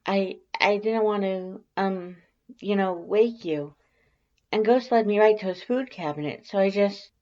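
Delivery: high, timid stammering voice; nervous stammer. Acted. Shy Alphys flustered about watching you sleep, high stammer. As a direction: stammer it out shyly, voice pitched well up.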